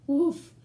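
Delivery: loud barked woof like a puppy; loud playful puppy woofs; dog bark. Acted. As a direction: bark it out like Biscuit. Dog barks in story.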